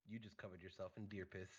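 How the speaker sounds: low, quiet deadpan delivery; retold in a straight-faced undertone. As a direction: say it dead serious, softly and low. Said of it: "With the straightest face".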